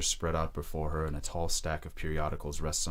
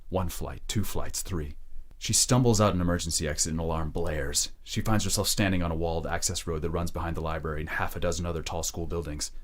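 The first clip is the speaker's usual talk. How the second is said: usual speaking voice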